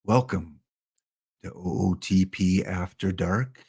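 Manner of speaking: smooth, jazzy late-night host, low and softened; Ezra Denny. Acted. Streamer imitating a colleague's late-night show intro, smooth jazzy voice. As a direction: play a laid-back after-dark radio host, pitched low. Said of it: "He's got the smooth, jazzy voice".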